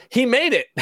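high, loud cry of relief, laughing; hammed-up high exclamation; mock exclamation. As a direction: read it out as a gleeful high shout. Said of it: "Megan says".

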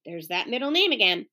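ordinary delivery, nothing marked

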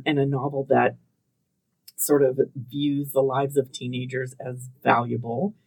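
ordinary talk

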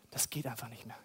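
unvoiced, ashamed hush; ashamed whisper. Believer confessing failure to God, whispered. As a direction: whisper it in shame.

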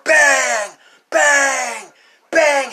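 high, loud shout of each bang; imitated banging. Storyteller imitating blows on a big metal door, shouted bangs.

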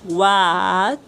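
slow, word-by-word model for the class; teacher dictating each word slowly; slow repeat-after-me. Shown as a demonstration. Teacher models the question slowly for students to repeat. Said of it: "Entonces repitan después de mí".

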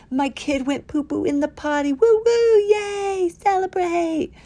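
high-pitched mock-proud squeal; celebratory cheer. Parent celebrating a kid's potty success, hammed-up cheering. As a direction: build from high glee to loud whoops.

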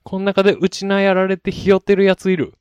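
loud, high-pitched Okinawan-style anime rallying cry; mock Okinawan accent. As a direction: shout the gang challenge in a clumsy Okinawan accent. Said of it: "東京リベンジャーズ沖縄バージョン". (沖縄なまり風に高く叫ぶ)

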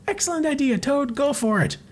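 high lightly cartoonish voice; character voice. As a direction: chirp it like a tiny comic sidekick. Comic character voiced in reading.

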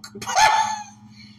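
sudden high-pitched burst of laughter. Real, not acted. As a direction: cracks up at the memory. Laughs at drunk dude story.

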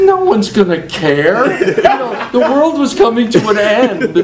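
laughing protest. Retold or imitated. His own laughing reply.

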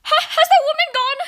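high, loud, nervous call with a shaky laugh; boy anxiously asks grandma if the witch is gone; anxious shout. Acted. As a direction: yell down with frightened urgency. Frightened boy calling down.

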